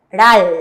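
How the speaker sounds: "ral" said loud, ending on a clear l; teacher reading the syllable aloud; model pronunciation. Shown as a demonstration. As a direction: announce "ral" loudly, with the final l sounding as an l, not an r. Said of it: "esta sílaba vamos a leer".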